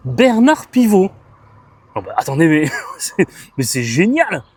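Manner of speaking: loud, high cry of discovery; real delighted amazement. Real, not acted. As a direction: exclaim it, laughing in disbelief. Découverte enthousiaste d'un livre. (émerveillement joyeux, exclamé)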